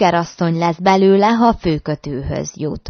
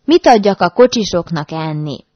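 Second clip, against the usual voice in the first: ordinary delivery, nothing marked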